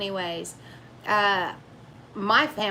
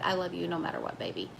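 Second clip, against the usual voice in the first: low, soft imitation of a husband; husband's reassurance; 5 semitones lower, 7 dB quieter, faster than usual. Wife imitating her husband telling her he loves her, soft and low. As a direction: drop down low and quiet for a loving husband. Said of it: "My husband will be like".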